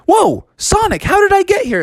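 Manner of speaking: very high astonished voice; high-pitched amazed questioning; amazed Froggy. Acted. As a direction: marvel as a cartoon kid. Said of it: "And Froggy was like".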